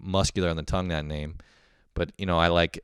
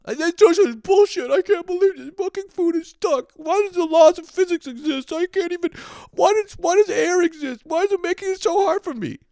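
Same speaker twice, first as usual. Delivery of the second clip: much higher, louder put-on whine of outrage; whiny tantrum at the world; 7 dB louder than usual. Yelling at a stuck-on dish in a high whiny tantrum voice. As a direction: play a petulant brat raging at physics. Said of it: "Who am I getting mad at".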